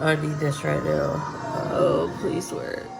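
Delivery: ordinary talk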